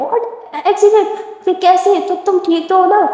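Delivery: high, alarmed aunt; shocked. Acted. Aunt hearing of an accident, shocked. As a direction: gasp it out in alarm.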